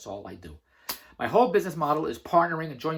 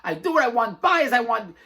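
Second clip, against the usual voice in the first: excited boast; 5 semitones higher, 4 dB louder than usual. Retold or imitated. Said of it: "I said".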